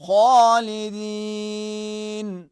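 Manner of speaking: slow melodic recitation; Quranic recitation. Shown as a demonstration. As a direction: recite it slowly like in prayer. Teacher reciting example verse.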